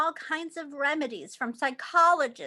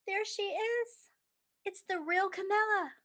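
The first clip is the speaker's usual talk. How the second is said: tender; 7 semitones higher than usual. A narrator's reading. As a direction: greet her fondly. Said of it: "greets the cured girl".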